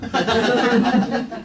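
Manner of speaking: a burst of real laughter; short amused chuckle. Real, not acted. Laughing at his own joke.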